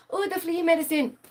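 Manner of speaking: high mocking voice of the husband; mock quote.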